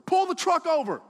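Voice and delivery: very high, loud shouted order; insistent mom's yell. Retold or imitated. Mom overruling dad on the highway, insistent yell. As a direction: yell it as a furious demand.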